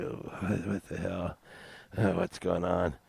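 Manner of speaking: soft confused grogginess; groggy mumble. Retold or imitated. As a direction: mumble like you just woke on the floor. Waking up groggy on floor.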